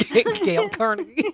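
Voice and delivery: high, loud words through real laughter; laughing at slip. Real, not acted. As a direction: crack up while repeating your own blunder. Cracks up at own slip.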